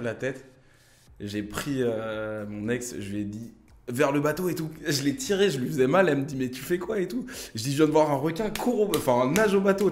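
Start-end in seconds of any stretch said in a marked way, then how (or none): 3.9-9.9 urgent panicked retelling, voice pitched up; rushing her back to the boat in a panic. Retold or imitated. Telling his ex to swim back, panicked urging. Panicked shouting at ex about shark.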